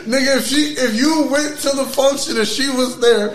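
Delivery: ordinary talk, nothing marked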